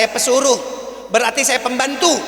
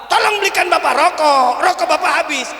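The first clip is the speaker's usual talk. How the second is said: acted yelling; 5 semitones higher than usual. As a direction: boss calling out an order, pitched up. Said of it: "Teriak lagi nggak?".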